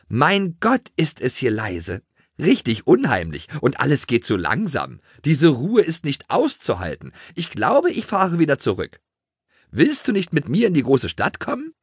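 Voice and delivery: high-pitched, exclaiming town mouse. A narrator's reading. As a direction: play the visiting mouse with big high swoops. Town mouse complains, then invites.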